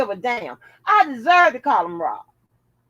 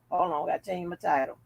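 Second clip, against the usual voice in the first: usual speaking voice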